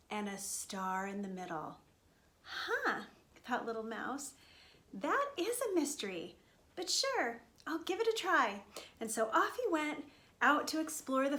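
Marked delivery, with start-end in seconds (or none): 2.4-3.0 high, puzzled "huh"; puzzled little mouse. Acted. Little mouse thinks over the mystery, high puzzled tone. 4.9-8.6 higher-pitched mouse voice; game little mouse. Acted. Little mouse accepts his parents' mystery, higher and willing.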